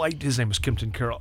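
ordinary delivery

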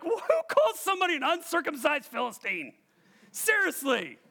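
high-pitched, disbelieving outcry; hammed-up incredulous reaction; loud incredulity. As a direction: exclaim it in mock astonishment. Acts out his astonished first reading.

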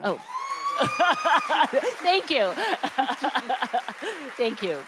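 high laughing thanks. Real, not acted. Author thanking the applauding audience, laughing. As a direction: laugh it out, touched and relieved.

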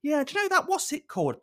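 high put-on missus voice; feigned forgetfulness. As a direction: mimic a partner pretending to grope for the name. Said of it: "makes out she can't remember".

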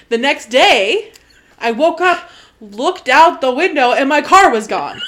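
ordinary talk, nothing marked

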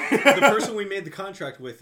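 loud laughing mercenary voice. Retold or imitated.

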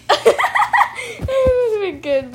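high, laughing delivery. Real, not acted. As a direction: laugh while you say it. Laughing at friend's joke.

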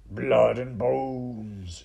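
very slow, loud ghoulish call; shouting rat. Acted. Another rat guesses at the smell, ghoulish call. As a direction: stretch the vowels with grisly relish. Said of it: "shouted another".

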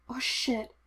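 soft, broken whimper. A narrator's reading. Obi-Wan in pain from the Force, whimpering. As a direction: let it crack out quietly. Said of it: "he whimpered".

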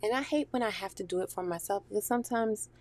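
ordinary delivery

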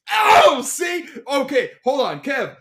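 high, loud excited rush; bursting with eager questions; excited planning. Real, not acted. Thrilled by the chat message.

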